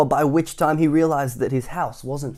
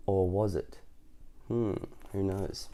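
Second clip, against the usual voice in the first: suspenseful hush; 3 semitones lower, 9 dB quieter, slower than usual. Real, not acted. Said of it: "teasing child about suspense".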